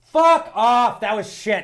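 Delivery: high, loud scornful outburst; mock outrage. Co-host berating a teammate's answer, mock angry yell. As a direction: tear into a useless teammate.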